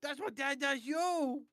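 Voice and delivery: high, drawn-out excited squeal; excited kid-like shout. Acted. Character thrilled about Dad, high excited shout. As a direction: shout it high, stretching the words.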